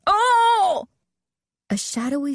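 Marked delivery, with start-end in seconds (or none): 0.1-0.9 sharp high startled cry; a sudden high gasp of fright. A narrator's reading. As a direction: jump at a sudden shadow. Sudden figure jumps out.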